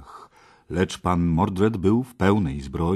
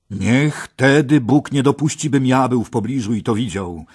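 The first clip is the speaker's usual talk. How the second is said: loud, higher outcry; exclaiming; 7 semitones higher, 10 dB louder than usual. A narrator's reading. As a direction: cry out in protest. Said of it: "wykrzyknął Gawain".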